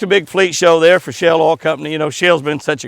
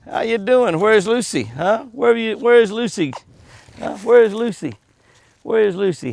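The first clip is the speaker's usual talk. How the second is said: higher, gentle calling to a deer; coaxing animal voice; 6 semitones higher, 3 dB quieter than usual. Real, not acted. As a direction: coax a shy animal closer.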